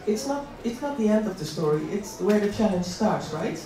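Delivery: usual speaking voice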